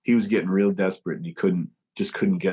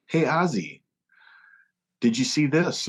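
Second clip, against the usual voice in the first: higher, playful bait; fake innocent question; 6 semitones higher than usual. Band baiting their tour manager Ozzy, higher mock-innocent call. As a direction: call his name like it's real news.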